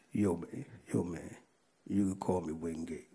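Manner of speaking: low, hushed imitation of an inmate; mock whisper. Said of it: "He kinda whispered and said".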